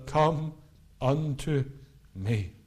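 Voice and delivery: Come, unto, me with long pauses between; tender pauses between come, unto, me; spaced out invitation. Acted. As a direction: lay each word down slowly.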